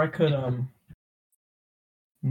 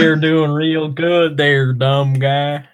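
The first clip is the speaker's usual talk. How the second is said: slow, loud Southern drawl; mock Southern; 5 dB louder, slower than usual. Ribbing a friend's accent attempt, mock Southern drawl. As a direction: lay on a teasing Southern accent.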